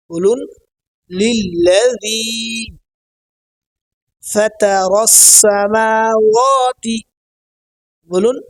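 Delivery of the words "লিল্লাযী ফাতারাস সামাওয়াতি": লিল্লাযী ফাতারাস সামাওয়াতি very slowly, long vowels held; correct pronunciation. Shown as a demonstration. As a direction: recite লিল্লাযী, pause, then stretch out ফাতারাস সামাওয়াতি. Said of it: "উচ্চারণগুলো সহি হয়".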